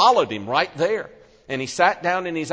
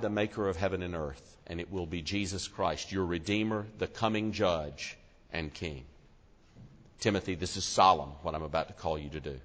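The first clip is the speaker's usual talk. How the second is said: hushed solemn charge; 8 semitones lower, 8 dB quieter than usual. Acted. Paul charging Timothy before God, hushed and grave. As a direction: drop to a reverent near-hush for God the Judge.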